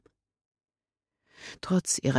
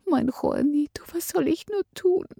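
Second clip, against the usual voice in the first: tearful lament; 12 semitones higher than usual. A narrator's reading. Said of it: "flüsterte sie weinend".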